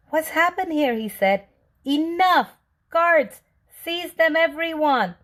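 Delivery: high, loud furious shouting; furious mayor. A narrator's reading. The mayor shouting as he finds his palace gone. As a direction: yell at everyone in outrage.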